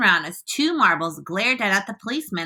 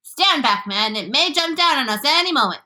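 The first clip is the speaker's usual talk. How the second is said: high, urgent warning; alarmed yell; 6 semitones higher than usual. A narrator's reading. Police chief fears the creature will jump, yelling. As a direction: warn them off in a raised pitch. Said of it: "yelled the chief of police".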